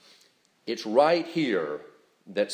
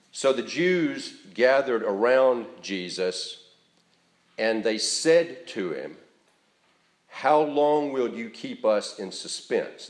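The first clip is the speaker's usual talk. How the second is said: slow reading, leaning on "gathered" and "said"; emphatic reading; slower than usual. Real, not acted. Preacher reading scripture to the congregation, verbs emphasized. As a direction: stress "gathered" and "said", taking it slowly. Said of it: "I'll try to give them emphasis as I read".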